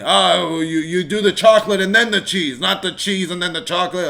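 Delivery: fussy, exaggerated nitpicking of snack order; mock athletes scolding couch critics about snacks. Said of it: "They're not over there like".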